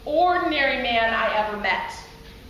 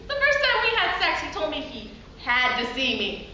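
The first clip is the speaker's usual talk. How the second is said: ordinary talk, nothing marked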